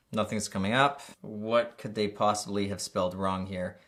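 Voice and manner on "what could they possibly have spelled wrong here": ordinary talk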